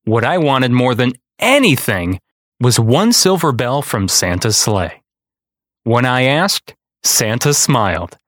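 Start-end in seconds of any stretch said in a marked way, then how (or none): none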